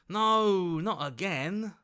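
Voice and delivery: a high, loud cry of "No"; dismayed no. Acted. Companion realising the disaster repeats, alarmed high cry. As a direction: cry out as dread hits.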